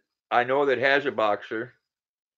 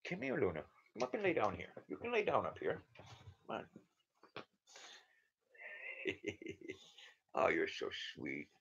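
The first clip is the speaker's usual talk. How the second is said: talking to a dog; 4 semitones lower, 16 dB quieter, faster than usual. Real, not acted. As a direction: coo gently to a sweet dog. Calls the dog up beside her.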